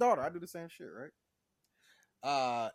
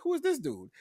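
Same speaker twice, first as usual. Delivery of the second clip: much higher, incredulous; high mock Bev; 13 semitones higher, 4 dB louder than usual. Bev asks about the crying man, pitched way up. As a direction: ask it pitched way up in disbelief.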